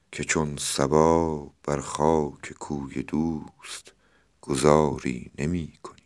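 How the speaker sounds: usual speaking voice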